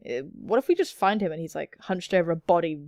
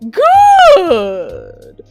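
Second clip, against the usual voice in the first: very loud, high, drawn-out cry; exaggerated bellow of approval; hammed-up shout; 9 semitones higher, 15 dB louder than usual. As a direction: boom the word and let it fall away. Acted boss's exaggerated approval.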